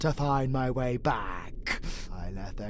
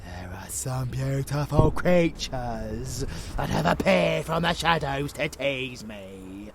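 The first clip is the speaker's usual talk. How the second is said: ordinary talk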